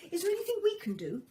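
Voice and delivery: high, fast little bird chirping; tiny bird voice. A narrator's reading. Two blue tits offer Lucy help, high and quick. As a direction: chirp it eagerly like a small bird.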